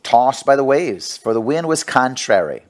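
measured reading of a Bible passage; scripture reading. Acted. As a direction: read the verses out to the congregation. Reads scripture passage aloud.

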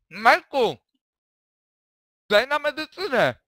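loud, high call to Majku. Acted. Calls across to Mike for help.

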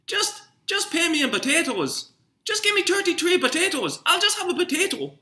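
very high, squeaky, whiny caricature voice; mimicking Irish coworker. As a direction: squeal it like a cartoonish coworker refusing money. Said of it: "I've got an Irish guy at work".

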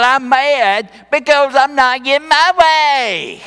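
mock petulant outburst, rising to a wail; mad, pouting tantrum. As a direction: throw a sulky tantrum, stretching the last word. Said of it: "You could translate it like that".

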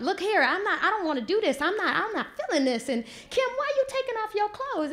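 put-on a scandalized little girl protesting; upset child protesting. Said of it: "I'm still talking about".

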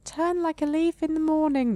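high, mournful tree voice; the tree face giving a riddle. Acted. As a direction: speak it like a sorrowful riddle. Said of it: "the face replies".

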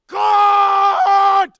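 high, loud, long-held yell; drawn-out shout. Acted. Actor capping the swear series, drawn-out shout. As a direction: stretch the vowel into a falling holler.

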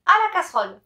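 angry command. Acted.

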